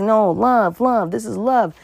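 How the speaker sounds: husband coaxing his wife with fake sweetness; mock soothing husband. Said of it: "He's like".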